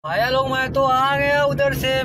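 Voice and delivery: high, loud, drawn-out hello to viewers; excited greeting. Real, not acted. Back home, hailing his viewers with an excited shout. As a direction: call out big and slow as you walk in.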